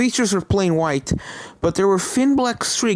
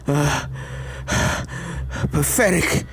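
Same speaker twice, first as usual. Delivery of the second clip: raspy. Acted. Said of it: "she rasped".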